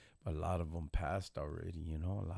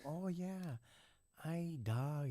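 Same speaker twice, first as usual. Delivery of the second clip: high-pitched mock voice of a fellow inmate; put-on high voice for a retold character; imitating chinito inmate; 9 semitones higher, 4 dB quieter, slower than usual. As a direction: mimic him in a raised, singsong pitch. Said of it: "this chinito. He goes".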